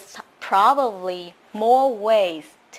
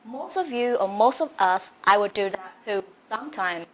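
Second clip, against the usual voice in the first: ordinary delivery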